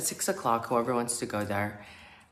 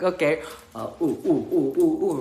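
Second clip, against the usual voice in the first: louder, unsure ooh ooh; hesitant ooh chant; 3 semitones higher, 6 dB louder than usual. Retold or imitated. Retelling joining in, a hesitant loud chant. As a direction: join the chant reluctantly. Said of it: "And I was like".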